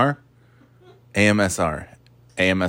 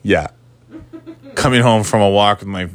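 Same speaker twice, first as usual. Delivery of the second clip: ordinary delivery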